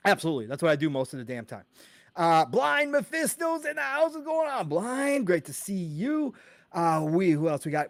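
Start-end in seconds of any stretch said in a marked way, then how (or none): 2.5-5.3 high-pitched announcing greeting; hyped shout-out. Real, not acted. Host greeting a viewer in the house, hyped. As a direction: sing out the arrival up high.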